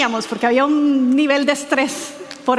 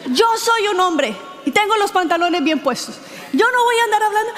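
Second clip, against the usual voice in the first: higher, gruff macho bluster, hammed up; mock macho husband; 6 semitones higher than usual. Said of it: "Y Danilo dijo".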